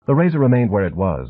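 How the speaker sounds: plain reading voice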